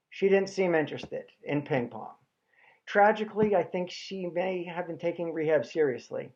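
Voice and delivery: normal talking voice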